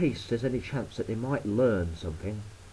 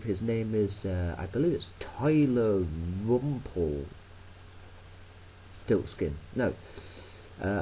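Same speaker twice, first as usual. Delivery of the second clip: ordinary talk, nothing marked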